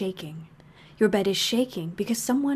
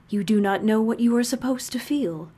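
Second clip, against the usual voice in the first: usual speaking voice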